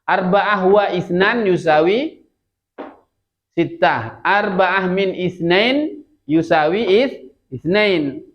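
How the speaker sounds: slow, measured Arabic recitation of sums; model reading. Shown as a demonstration. Teacher contrasting two sums for students, slow model reading. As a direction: set both forms out slowly. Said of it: "Kalau di sini isnan tadi".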